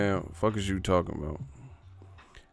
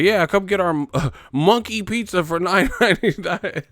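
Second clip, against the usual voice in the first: mock pizza boss; 8 semitones higher, 5 dB louder than usual. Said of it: "he's like".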